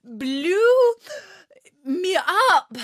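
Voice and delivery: high, loud, breathless gasps; panting. A narrator's reading. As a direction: push it out, still out of breath. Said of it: "panted the queen".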